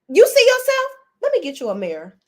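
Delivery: high, loud mocking jab. Mocking Greg, high shout.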